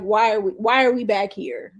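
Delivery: ordinary talk, nothing marked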